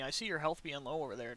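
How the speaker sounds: ordinary talk, nothing marked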